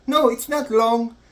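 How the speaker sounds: high, slow comic insistence; puzzled correction. Acted. Canadian nurse repeating herself to the patient, slow high correction.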